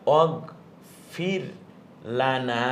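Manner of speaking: slow, syllable by syllable "ওয়াগফির লানা"; careful slow spelling of "লানা"; spelled-out recitation. Shown as a demonstration. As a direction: sound out "ওয়াগফির" then "লানা" with a held final a. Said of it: "বানান উচ্চারণ করেন".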